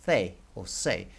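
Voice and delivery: "C" said THEH, then "se" said SEH; lisped THEH for "C", or SEH; Spanish letter name. Shown as a demonstration. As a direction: give "C" both ways, THEH or SEH. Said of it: "how you say C in Spanish".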